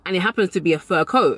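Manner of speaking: usual speaking voice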